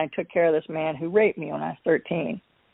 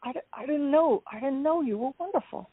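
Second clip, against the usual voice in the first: father pleading softly; 6 semitones higher, 7 dB quieter than usual. Retold or imitated. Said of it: "And he goes".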